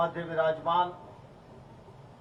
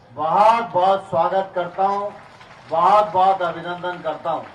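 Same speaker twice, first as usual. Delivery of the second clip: grand entrance announcement; 5 dB louder than usual. Real, not acted. Welcomes chief minister on arrival.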